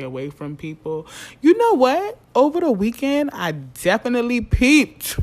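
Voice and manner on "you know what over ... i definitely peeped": high and loud, bursting with news; excited outburst. Real, not acted. Telling listeners the weekend gossip, high excited outburst. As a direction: blurt it out like big gossip.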